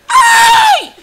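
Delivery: long, loud, piercing "ai" scream; high exclaiming scream. Real, not acted. Guest capping his rant to the host, piercing scream. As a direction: burst out at the very top of the voice. Outburst ending a heated rant.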